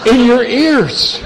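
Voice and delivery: put-on high, slow, sweet little girl voice, amazed; a sweet granddaughter gasping at Pa's singed face. Granddaughter to burnt grandpa, high and amazed.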